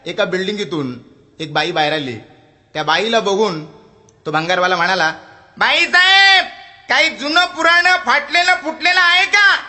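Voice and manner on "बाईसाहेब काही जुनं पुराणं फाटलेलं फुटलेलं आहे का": very high, loud hawker's call, stretching the last भंगार; scrap dealer's street cry. Acted. Scrap dealer shouts his wares along the street. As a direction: sing it out down the street.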